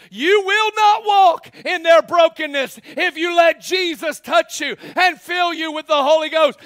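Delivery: loud, high, shouting sermon; full-force preaching. Acted. Preacher shouting to the congregation, full-force preaching.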